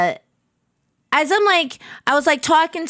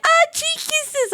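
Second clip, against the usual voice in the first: delighted cheek kisses; slower than usual. Retold or imitated. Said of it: "And they're like".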